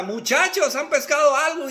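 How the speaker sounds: loud, high shout to men far off; calling out. Acted. Jesus calling to his disciples out fishing, shouted. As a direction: holler it across the water. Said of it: "y les grita".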